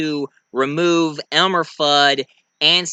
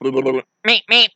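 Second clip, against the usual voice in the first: road runner meep; 3 semitones lower, 3 dB louder than usual. Retold or imitated. Road runner call after coyote.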